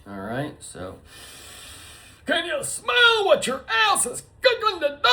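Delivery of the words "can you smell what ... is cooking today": hammed-up announcer. Wrestler-style catchphrase taunt.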